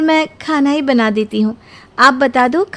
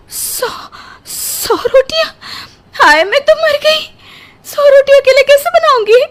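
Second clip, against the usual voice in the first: high wailing sob over the rotis; wailing in despair; 11 semitones higher, 4 dB louder than usual. Acted. New bride wailing to herself about cooking a hundred rotis, sobbing. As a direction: cry out in comic despair.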